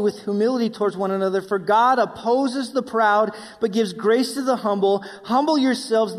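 loud, driving pulpit delivery; full-force preaching. Acted. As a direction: preach it out to the whole congregation. Preaching the scripture passage.

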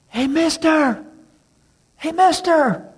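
put-on eager kid hollering for attention; boy yelling. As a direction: shout across the fence like a kid. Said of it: "he heard some boy yelling".

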